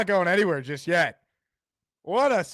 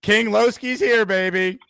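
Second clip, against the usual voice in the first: hype announcer intro; 3 dB louder than usual. Acted. Host hypes the caller's entrance.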